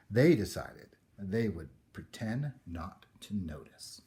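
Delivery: ordinary delivery, nothing marked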